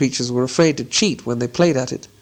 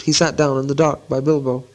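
plain reading voice

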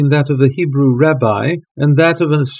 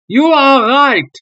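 high, loud, slow outburst; king cries out, unmasked at last; cried out; 11 semitones higher, 4 dB louder, slower than usual. A narrator's reading. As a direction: let it burst out in desperation. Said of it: "he cried".

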